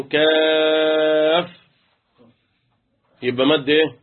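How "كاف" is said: كاف chanted with its alef held long; drawn-out madd. Shown as a demonstration. Teacher chanting كاف for the lesson, long and drawn out. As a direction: sing كاف slowly in recitation style. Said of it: "مد لازم حرف إيه".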